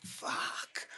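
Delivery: hushed, voiceless retold curse; whispered dismay. Painter caught out by cop's video evidence, whispered dismay. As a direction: let the curse out under the breath.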